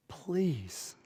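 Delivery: barely audible, pleading please; a tired man's hushed please; quiet plea. Retold or imitated. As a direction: beg softly, worn out and desperate. Exhausted husband begs innkeeper.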